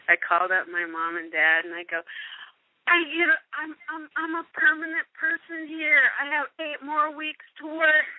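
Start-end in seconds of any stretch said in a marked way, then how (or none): 2.9-8.1 high-pitched, breaking the big news; retold thrilled phone call to mom and dad; so excited. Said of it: "I was so excited".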